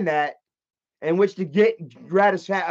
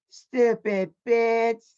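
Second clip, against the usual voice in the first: put-on slow, higher little-kid voice; four-year-old; 4 semitones higher, much slower than usual. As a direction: imitate a small child cursing. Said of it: "it sounded like a fucking four-year-old".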